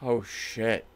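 low, hushed dread; dismayed realisation. Acted. Ethan realises what he handed over, quietly dismayed. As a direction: let it sink in softly.

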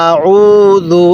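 আউযু very slow, middle vowel held level; one-alif elongation. Shown as a demonstration. Teacher asking learner to repeat along, drawn-out আউযু. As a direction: chant আউযু slowly, stretching the উ. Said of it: "এরকমভাবে শিখব".

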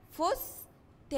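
فستان stretched slowly, syllable by syllable; slow demo word. Shown as a demonstration. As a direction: draw out فستان for beginners. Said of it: "كلمة".

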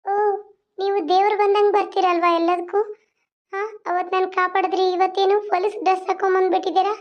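high put-on girl's voice, fretting; a frightened village belle complaining about the loafer. Heroine greets the inspector.

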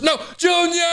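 very high, hammed-up shriek; panicked cry. Mama rabbit watching Junior get found, panicked cry. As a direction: wail the child's name in mock horror. Game intruder finds Junior in fridge.

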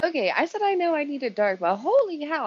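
loud and high, stunned; reacting to a dark prologue with loud, high surprise; real shock. Real, not acted. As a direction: blurt it out, overwhelmed.

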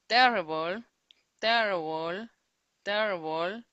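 terrible with a loud first syllable; stress on TERrible. Shown as a demonstration. Teacher repeating terrible for the class, first syllable loud. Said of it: "Esta sí tiene tres".

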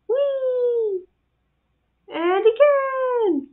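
high sing-song glee; playful whee. Pretend. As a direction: cheer like a kid on a ride. Voicing dog on a slide.

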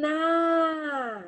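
Na called out clearly; teacher modelling Na; syllable Na. Shown as a demonstration. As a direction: stretch the syllable Na. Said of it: "aquilo que a gente ouvir".

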